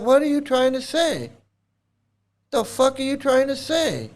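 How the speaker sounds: high-pitched exasperated retort; incredulous, pitched well up; indignant challenge. Real, not acted. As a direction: throw the question back, indignant and high, without shouting. Indignant retort to the quoted excuse.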